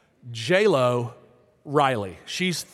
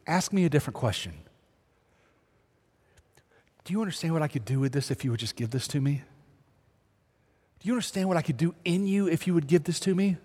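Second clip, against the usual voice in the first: softly spoken, quicker coaxing words from God; God leaning over; 4 dB quieter, faster than usual. Acted. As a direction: lean in quietly as God, gently offering. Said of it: "kinda leaning over, just going".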